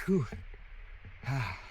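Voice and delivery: out of breath from the climb; winded, legs burning on the climb; after a workout. Real, not acted. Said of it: "The legs are burning".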